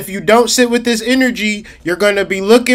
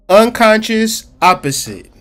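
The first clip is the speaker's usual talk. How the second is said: normal talking voice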